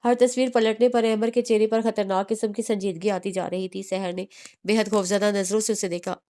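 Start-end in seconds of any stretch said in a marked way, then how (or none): none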